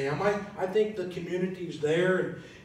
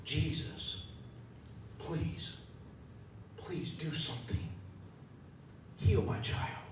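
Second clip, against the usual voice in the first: softer, half-whispered begging; pleading; 9 dB quieter than usual. Acted. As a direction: beg for a dying child under your breath.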